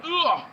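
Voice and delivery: high-pitched mock groan; hammed-up grunt of someone hit; pained groan. Gamer voices the downed figure, high pained groan.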